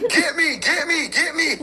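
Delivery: high, laughing plea of get me; laughing mock Jesus. Mocking baby Jesus begging to be grabbed, high and laughing. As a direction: squeal get me through laughter. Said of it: "Jesus was like".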